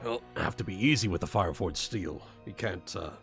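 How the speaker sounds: ordinary delivery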